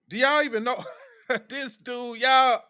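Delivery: high, laughing through the words; laughing disbelief. Real, not acted. Ask the viewers, laughing in disbelief. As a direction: laugh at how absurd it is.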